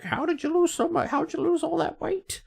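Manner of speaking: high-pitched mock voice of an amazed questioner. People quizzing an actor about his weight loss, high mock questioner.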